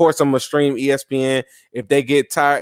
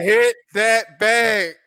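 slow quote; 4 semitones higher, much slower than usual. Retold or imitated. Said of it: "that man said".

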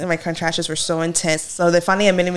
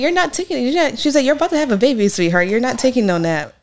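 higher, teasing mock-stern doctor; 6 semitones higher than usual. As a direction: tease her like a firm doctor who won't allow a nap. Doctor scolds mother in labor.

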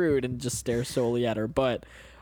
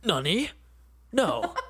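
anime voice; 6 semitones higher, slower than usual. Acted. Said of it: "It's anime".

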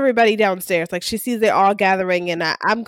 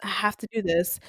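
retold sad resolve; 5 semitones lower, 7 dB quieter than usual. Sad Diego resolves to shut Mom down.

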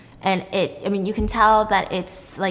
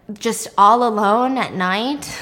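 ordinary talk

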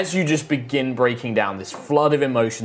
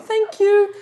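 high, polite little thanks; high-pitched creature voice; tree woman; 4 dB louder than usual. Acted. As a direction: say it in a high put-on voice.

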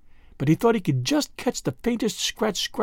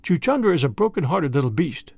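plain reading voice

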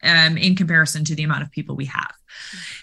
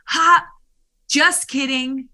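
cheeky tease; 9 semitones higher, 3 dB louder, slower than usual. Retold or imitated. Player teasing coach publicly.

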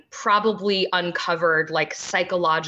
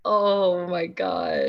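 gushing; much slower than usual. Real, not acted. Gushing over baby.

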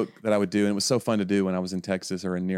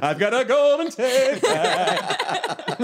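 put-on squeaky laughing kid; 14 semitones higher, much slower than usual.

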